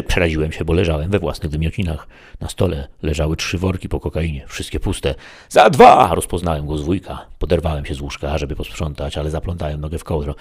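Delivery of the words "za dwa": very loud, high-pitched call; police counting down to a raid; shouted countdown. A narrator's reading. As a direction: shout the count through the door.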